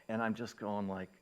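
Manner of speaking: ordinary delivery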